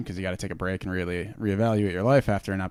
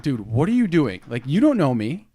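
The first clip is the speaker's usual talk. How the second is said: incredulous; 5 semitones higher, 6 dB louder than usual. Retold or imitated. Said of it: "And I'm just like".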